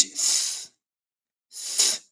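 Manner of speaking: voiceless th hissed out unvoiced; exaggerated th practice. Shown as a demonstration. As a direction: blow air through the teeth, no voice. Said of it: "First, the voiceless T H".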